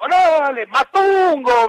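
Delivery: high, loud, stretched-out heckle; don Vittorio shouting. Retold or imitated. Coach heckling the young player, imitated shout. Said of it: "me gritaba don Vittorio allá".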